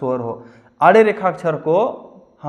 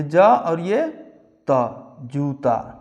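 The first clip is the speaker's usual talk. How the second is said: त ... जूता drawn out; slow spelling; 3 semitones lower, much slower than usual. Shown as a demonstration. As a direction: spell then join. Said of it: "teacher spells out जूता". (धीमे हिज्जे)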